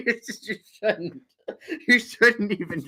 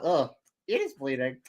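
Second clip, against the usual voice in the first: usual speaking voice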